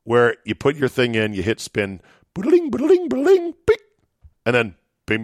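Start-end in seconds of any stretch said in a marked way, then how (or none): 2.3-3.8 high sing-song boodling jingle, much higher; slot machine jingle. Retold or imitated. Describing slots to listeners, a high mimicked machine jingle. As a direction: imitate a slot machine's happy chime, way up high. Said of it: "ring you up as winning".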